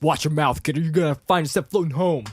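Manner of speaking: hammed-up warning; mock scolding. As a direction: put the kid in his place. Retort to Luke in scene.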